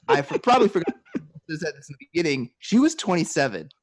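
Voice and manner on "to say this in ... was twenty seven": ordinary talk, nothing marked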